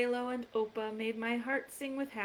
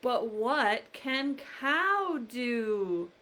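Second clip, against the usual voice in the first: slow teasing question; 3 dB louder, much slower than usual. Acted. Refrain asked to children.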